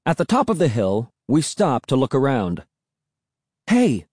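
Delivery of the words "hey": high-pitched call of discovery; excited cry. A narrator's reading. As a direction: cry out on spotting something, pitched up. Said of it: "I cried".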